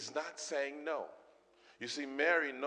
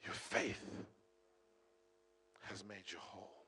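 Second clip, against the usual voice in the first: very soft, dropping away; hushed, moved Jesus; hushed awe; 5 semitones lower, 9 dB quieter than usual. Acted. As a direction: say it as if deeply moved, barely voiced. Jesus moved, hushed after the climax.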